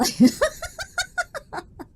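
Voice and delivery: long squeaky high giggle. Real, not acted. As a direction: crack up helplessly. Can't keep straight face.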